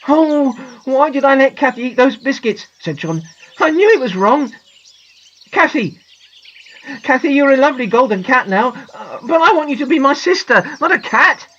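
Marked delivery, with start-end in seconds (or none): 0.1-2.7 high, loud lament; distressed. A narrator's reading. Boy blaming himself for his sister's change, distressed. 3.6-11.5 high, pleading lament; distressed. A narrator's reading. Boy pleading with his cat sister, distressed.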